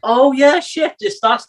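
suddenly recognizing a song, excited; surprised recognition. Retold or imitated. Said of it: "And I'm like".

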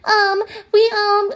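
put-on squeaky high phone voice of the store girl; high-pitched voice of the mall employee on the phone; high-pitched sales girl. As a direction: mimic the sweet high voice of the saleswoman. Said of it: "with, like, a high-pitched voice".

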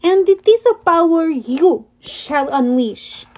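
put-on slow, menacing heavy French accent; a villain savoring his French accent. The Manticore ambushes the heroes, slow heavy French accent. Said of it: "in a heavy French accent".

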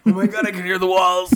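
laughing mock panic. Real, not acted. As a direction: squeal it through laughter. Laughing panic about episode.

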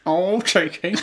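playful mock British accent; put-on British accent. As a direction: tease in a hammed-up British accent. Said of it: "hold these accents".

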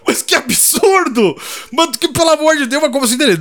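outraged disbelief. Retold or imitated. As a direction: sputter in disbelief. Customer outraged at the offer.

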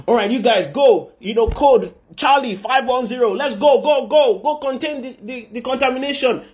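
high, hurried emergency dispatch; urgent commands. Acted. Michael dispatches angels to contain the contamination, urgent commands. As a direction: send the squad out in a panic.

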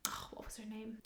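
breathy whisper to herself, searching memory; whispered aside. Real, not acted. Trying to recall the waitress's name, whispering. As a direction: murmur it under your breath, trying to remember.

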